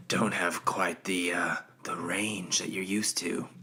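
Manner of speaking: soft, low, worn-out voice; throat tired from screaming, low and soft; shot throat. Real, not acted. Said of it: "My throat's a little shot right now".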